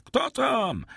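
very high-pitched cry; startled yell. A narrator's reading. Husband startled by banging at the door, high yell. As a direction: yell it high, without much added volume. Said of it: "wrzasnął Kaderus".

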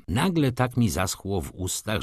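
ordinary delivery, nothing marked

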